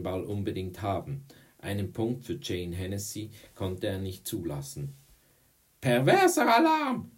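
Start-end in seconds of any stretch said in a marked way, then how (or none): 5.8-7.1 high, loud alarm cry; screaming. A narrator's reading. Girl sounds the alarm on a boy, screaming. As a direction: scream it like a siren. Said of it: "schrie Courtney".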